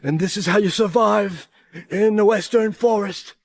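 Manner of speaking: slow, grave survival-show host; Bear Grylls. Pretend. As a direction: narrate like a TV survivalist. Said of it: "I'm Bear Grylls".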